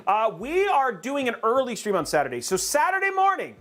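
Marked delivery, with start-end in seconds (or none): 2.6-3.5 sing-song. Shown as a demonstration. Streamer hyping Saturday morning to chat, much higher. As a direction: sing out the day like a host. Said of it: "everybody say Saturday morning".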